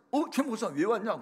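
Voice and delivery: high-pitched retold surprise; startled. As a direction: act out being caught off guard by a visitor. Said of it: "갔더니 깜짝 놀라면서". (깜짝 놀란 듯 높은 목소리로 되묻기)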